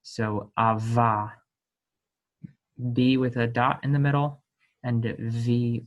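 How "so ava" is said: "ava" slow, with a buzzing V; demo of V. Shown as a demonstration. Teacher to students, showing bet without the dot. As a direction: draw out "ava" slowly on the V. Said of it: "we actually pronounce it like a V".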